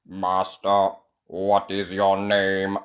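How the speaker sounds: low, slow robot monotone; toy robot. A narrator's reading. Robot waking to meet Ben, low robotic voice. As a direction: speak evenly like a machine.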